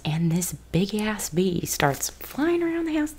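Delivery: breathy ASMR whisper. Acted. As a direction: whisper close to the mic.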